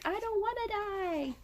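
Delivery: high, soft frightened plea; mock terrified whimper. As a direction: beg for your life in a thin high voice. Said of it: "he's like".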